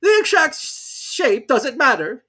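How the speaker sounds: high, loud, slow pompous voice; Dodo voice. Acted. Dodo marking the course, high and slow. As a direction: declare it grandly. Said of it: "it said".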